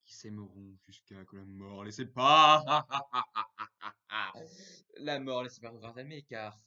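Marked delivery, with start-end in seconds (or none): 0.0-2.7 jusqu'à que la mort les sépare boomed out loud; dramatic proclamation. Acted. Same phrase, hammed up loud. 2.7-4.9 loud laugh. Real, not acted. Laugh after dramatic line.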